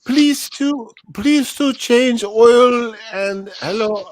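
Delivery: high, loud, drawn-out caricature voice; slow, hammed-up foreign helpline caller; Pedro. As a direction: put on a squeaky, halting comic accent. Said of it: "That's Pedro".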